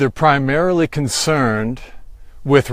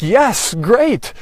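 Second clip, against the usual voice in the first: bright, higher mock-cheerful yes; higher, cheerful welcome of the verdict; cheerful retort; 6 semitones higher than usual. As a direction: answer the threat with delight. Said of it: "I'm like".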